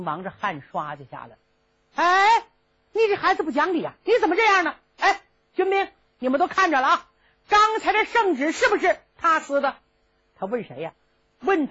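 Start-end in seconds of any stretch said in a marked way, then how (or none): 1.9-9.8 put-on high, exasperated old prince; flustered royal, pitched up; indignant prince. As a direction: complain fast, then call on the soldiers. Prince appeals to soldiers.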